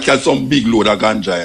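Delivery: usual speaking voice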